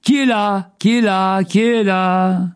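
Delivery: high, sustained echo of a call; voix qui répondent en écho. A narrator's reading. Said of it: "il entendit aussitôt d'autres voix répondre". (écho aigu qui se répète)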